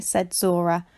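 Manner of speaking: usual speaking voice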